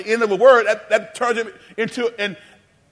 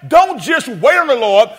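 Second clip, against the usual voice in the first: ordinary talk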